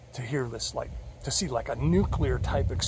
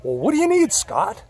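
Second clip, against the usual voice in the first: much higher and loud, exasperated; God pressing Scott, high and loud; Heavenly Father's voice; 8 semitones higher, 9 dB louder than usual. Retold or imitated. God nudging lost Scott, loud high exasperation. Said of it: "this is like Heavenly Father talking to me".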